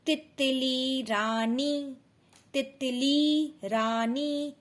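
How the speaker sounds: तितली रानी drawn out very slowly; बच्चे के लिए बहुत धीरे पढ़ना; slow reading aloud. Shown as a demonstration. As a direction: read it like a first reader for a child. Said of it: "छोटे छोटे वाक्यों पर".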